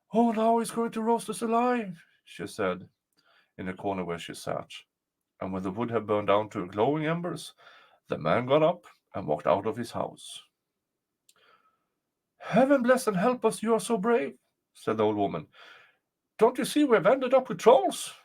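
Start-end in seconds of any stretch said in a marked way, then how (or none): 0.1-2.0 high, frightened wail; afraid old woman. A narrator's reading. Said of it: "This made the old woman still more afraid". 12.4-14.4 high-pitched, alarmed exclamation; frightened old woman. A narrator's reading. 16.4-18.1 high, louder frightened protest; alarmed old woman. A narrator's reading. Frightened old woman warns boy.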